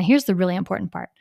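ordinary delivery

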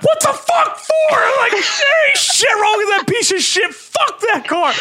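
high, loud mock shouting, half-crying; retold rage. Yelling at his wife about leaving a note, a hammed-up furious shout. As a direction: rant like he's losing it at his wife. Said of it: "all kinds of engulfed in rage".

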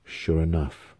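ordinary delivery

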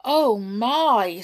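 upset brownies, storybook alarm. A narrator's reading. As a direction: exclaim in worry. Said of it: "feeling very upset indeed".

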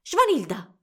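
high, loud call of a name. A narrator's reading. Friend calling the girl over to help, shouting. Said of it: "gridò qualcuno".

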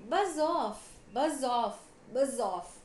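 loud repeated shout. A narrator's reading. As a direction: shout it to shoo the flies. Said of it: "the elephant shouted".

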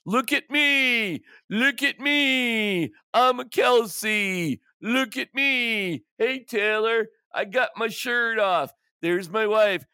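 high, slow, showing-off mock Kelce; imitating a shirtless player showing off to [name]; mocking Jason Kelce. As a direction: ham up a braggart yelling from the box. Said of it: "there's Jason Kelce taking his damn shirt off".

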